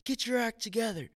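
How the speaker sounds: high, hammed-up telling-off; rash rebuke, acted. Preacher showing how not to correct a leader, mock rebuke. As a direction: imitate someone harshly dressing down a leader. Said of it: "rash thinking or, or just saying".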